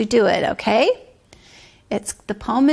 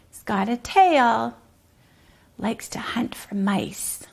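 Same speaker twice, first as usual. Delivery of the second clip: ordinary delivery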